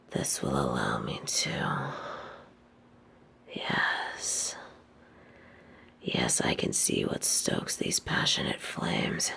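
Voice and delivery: slow, menacing whisper close to her ear; sinister hushed whisper. Acted. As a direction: breathe the words right into her ear. Villain whispers to captive.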